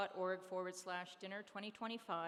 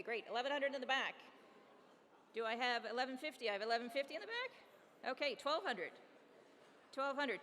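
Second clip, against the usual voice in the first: brisk, raised auction patter, pushing the room for higher bids; auctioneer calling bids; 4 semitones higher, 4 dB louder than usual. Acted. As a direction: work the room like a charity auctioneer. Live auction bid calling.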